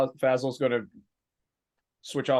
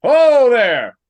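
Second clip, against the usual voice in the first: loud high hail; calling out; 10 semitones higher, 13 dB louder than usual. Acted. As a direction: shout across the yard. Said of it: "I would call out".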